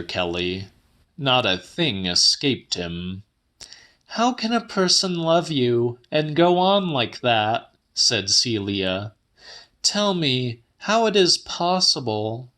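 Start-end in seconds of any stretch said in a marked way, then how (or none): 4.1-7.7 voiced much higher as the woman; Celia's voice. A narrator's reading. Woman telling the old man her news, higher voice. 9.8-12.5 voiced much higher as the woman; Celia's voice. A narrator's reading. Woman telling the old man her news, higher voice.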